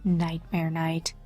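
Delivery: ordinary delivery, nothing marked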